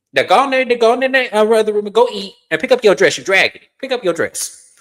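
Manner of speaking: high, loud motherly scolding; scolding mom. Retold or imitated. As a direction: shoo her off like a bossy mama. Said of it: "And she said".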